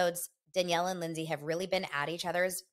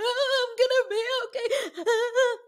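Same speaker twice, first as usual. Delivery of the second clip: high, wailing fake cry; mock sobbing; 8 dB louder, slower than usual. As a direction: sob it out, pitched way up and slow. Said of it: "She's like".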